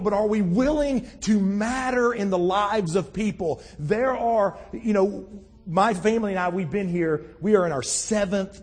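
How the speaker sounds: high, loud, impassioned preaching. Acted. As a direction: preach it out with full conviction. Preaches at full force.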